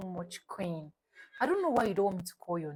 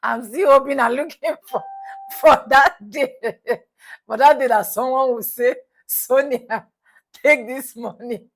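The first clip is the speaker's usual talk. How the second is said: much louder and higher, giggling through it; giddy laughing dream; laughing daydream; 4 semitones higher, 16 dB louder than usual. Real, not acted. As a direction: wish aloud, laughing at the fantasy. Laughing wishful fantasy.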